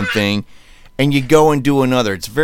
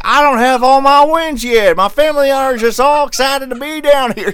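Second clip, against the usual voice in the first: mock Southern accent; 12 semitones higher, 8 dB louder than usual. Coach from Massachusetts giving a pep speech, in a mock Southern accent. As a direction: belt it out in a put-on Southern accent. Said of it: "in a Southern accent".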